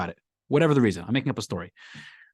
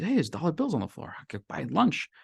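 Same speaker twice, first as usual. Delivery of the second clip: higher, softer voice of a lucky finder; hushed mock delight; 5 semitones higher, 4 dB quieter than usual. Hungry poor person sees dollar bills, hushed mock delight. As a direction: play-act a happy find, lightly. Poor man spots the money.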